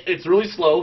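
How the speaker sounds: usual speaking voice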